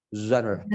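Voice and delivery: "genre" starting on buzzing zh; no j in "genre", blow a zh; zh, not j. Shown as a demonstration. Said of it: "bukan pakai J, tapi di Z".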